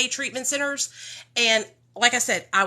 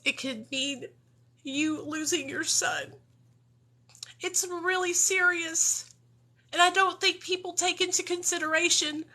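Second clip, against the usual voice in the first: emotional; 5 semitones higher, 5 dB quieter than usual. Real, not acted. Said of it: "I'm really emotional right now".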